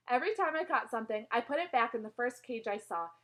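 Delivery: ordinary delivery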